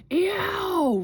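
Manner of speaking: loud, high howl of a tormented giant; roared cry of pain. A narrator's reading. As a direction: yowl at the top of your voice, long and high. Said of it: "roared the flesh lump eater".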